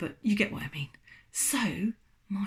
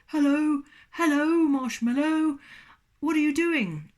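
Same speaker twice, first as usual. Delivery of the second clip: higher, louder greeting pillow voice; cheerful pillow; 8 semitones higher, 7 dB louder, slower than usual. Acted. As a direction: greet the newcomer brightly. Pillow uncovers its mouth.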